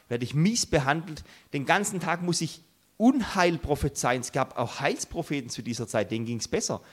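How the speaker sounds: normal talking voice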